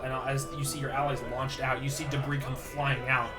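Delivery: normal talking voice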